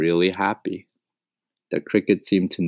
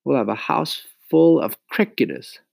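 high-pitched mother protesting; complaining mama; 8 semitones higher, 4 dB louder than usual. A narrator's reading. Mama objects to a house full of crickets, complaining.

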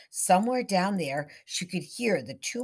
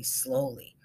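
ordinary delivery

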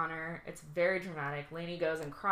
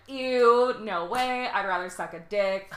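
disgusted; 3 semitones higher, 7 dB louder than usual. Retold or imitated. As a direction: recoil in disgust. Disgusted jock quote.

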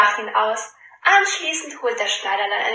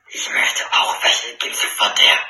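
low, growling greedy bear; deep bear growl; 12 semitones lower than usual. Acted. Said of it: "Der Bär brummt mit tiefer Stimme". (tiefe Brummstimme eines Bären)

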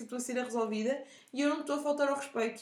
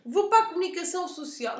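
loud, higher mock threat; threatening customer; 6 semitones higher, 7 dB louder than usual. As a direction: threaten like an outraged caller. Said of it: "o meu top três de ameaças". (cliente a ameaçar)

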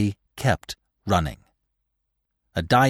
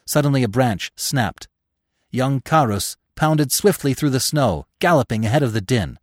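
plain reading voice

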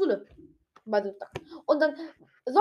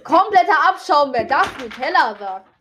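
mock outrage; 6 semitones higher, 9 dB louder than usual. Mock outrage over "das Nutella.

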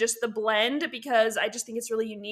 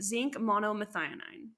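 ordinary talk

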